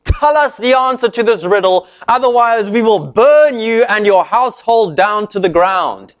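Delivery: menacing demand, pitched high; dramatic threat. Retold or imitated. Villagers threatening the bride, dramatic menace. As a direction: threaten them with burning, hammed up. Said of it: "Talk about dramatic".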